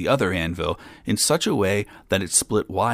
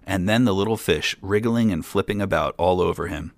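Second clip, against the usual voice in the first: ordinary delivery, nothing marked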